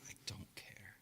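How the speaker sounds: breathy unvoiced whisper. Real, not acted. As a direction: whisper it under your breath. Whispered aside during chat.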